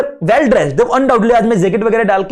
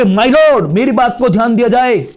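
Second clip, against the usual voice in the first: a firm, louder "My lord" to open a plea; slightly raised, deliberate address to the judge; courtroom address demo; 3 semitones higher, 4 dB louder than usual. Shown as a demonstration. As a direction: plead to the bench with weight. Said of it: "voice modulation का ध्यान रखना है".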